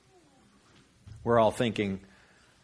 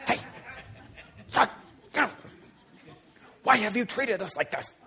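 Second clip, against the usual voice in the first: high, strained stage whisper; stern whisper; 11 semitones higher than usual. Acted. Mom to son in church, stern stage whisper. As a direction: scold under your breath like a mom at church. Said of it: "whispered it a little bit".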